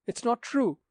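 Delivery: high-pitched cry of denial; high protesting cry. Acted. Daughter crying out against the captain's accusation, high and pleading.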